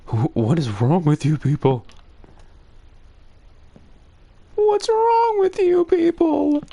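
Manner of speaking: climbing into a squeaky, high outraged cry; exasperated disbelief. Retold or imitated. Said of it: "I was like".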